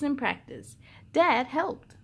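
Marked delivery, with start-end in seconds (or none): none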